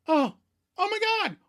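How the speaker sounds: high-pitched hammed-up realization; falsetto Rachel. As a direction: squeak it out like a shocked girlfriend. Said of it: "Rachel finally realizes her own feelings".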